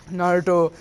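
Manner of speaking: plain reading voice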